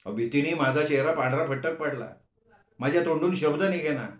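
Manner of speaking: usual speaking voice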